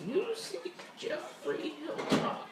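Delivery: high, slow mystic voice; eerie fortune teller speaking in the mind. Acted. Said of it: "you hear the voice of the fortune teller".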